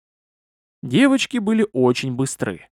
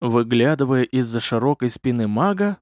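usual speaking voice

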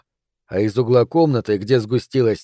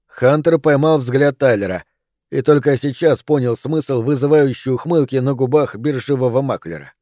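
ordinary delivery, nothing marked

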